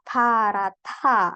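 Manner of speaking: "parata" slowed down, breathy "th" sound. Shown as a demonstration. Teacher stressing the H sound to the student, slow "parata". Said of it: "yang ada bunyi H-nya".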